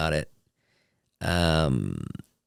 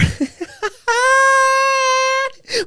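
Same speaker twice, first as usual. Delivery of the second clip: a shrieking giggle fit; high squealing laugh. Real, not acted. Comic telling the elevator story, high squealing laugh.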